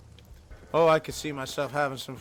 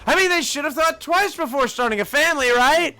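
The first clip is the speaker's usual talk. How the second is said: a touch of aggrieved self-defence, pitched up; lightly acted, high defensive CEO; indignant boss; 9 semitones higher, 6 dB louder than usual. CEO excusing himself about his workers, mildly indignant.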